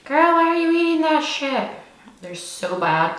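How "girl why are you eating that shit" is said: high-pitched mock-disapproving critic; exaggerated high "girl, really?" voice; scolding onlooker. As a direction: imitate a judgy commenter, pitched way up. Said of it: "people are probably gonna be like".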